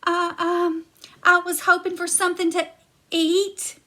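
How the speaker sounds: timid Jack caught in the kitchen; nervous stammer. Acted. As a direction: rush it out, flustered.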